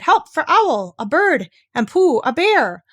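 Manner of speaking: high, loud call for help; shouted call. Acted. Piglet shouting for help in Pooh's hum, loud. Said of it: "he stood and shouted".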